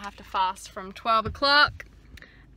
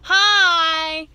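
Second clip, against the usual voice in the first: excited greeting; 6 semitones higher, 11 dB louder than usual. Real, not acted. Excited vlog greeting to camera.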